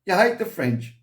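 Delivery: high, pointed mock accusation; accusing brother. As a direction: brother calling him out with a jab. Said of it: "And he said".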